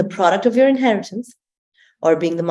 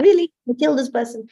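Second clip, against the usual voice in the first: "Really?" much higher, an incredulous gasp; mock disbelief; 8 semitones higher than usual. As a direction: play disbelief at a friend's wild plan. Mock disbelief at friend's plan.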